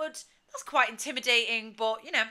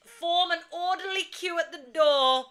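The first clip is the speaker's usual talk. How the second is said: raised, louder call to a crowd; mock announcement; 8 semitones higher, 6 dB louder than usual. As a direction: call out like marshalling a queue.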